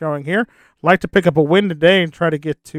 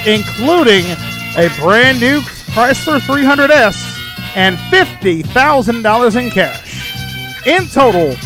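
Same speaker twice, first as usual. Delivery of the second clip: big, louder, higher prize announcement; booming prize announcer; 5 semitones higher, 4 dB louder than usual. Acted. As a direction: boom out every prize. Prize rundown over music.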